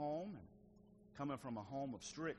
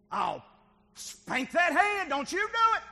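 very loud, high, drawn-out threat; acted threatening shout; 16 dB louder, slower than usual. Parent threatening to spank a hand, hammed-up shout. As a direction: holler it like a parent at the end of patience.

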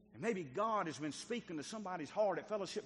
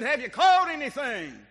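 calling out; 6 semitones higher, 14 dB louder, slower than usual. Retold or imitated. Jesus calling to the boat.

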